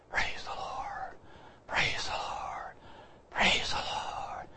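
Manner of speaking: slow, faint whispered praise; dying woman's whisper. Retold or imitated. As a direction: breathe it out like on a deathbed. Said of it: "she was going".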